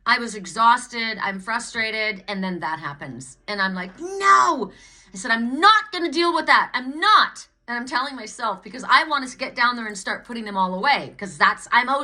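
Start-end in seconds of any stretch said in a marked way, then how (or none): 4.0-4.7 high, loud exasperated no; fed-up shout; angry no. Retold or imitated. Retelling fury at fallen earrings. 5.3-7.5 higher, louder defiant refusal; flatly refuse, loud and fed up; stubborn angry insistence. Retold or imitated. Retelling refusal in anger.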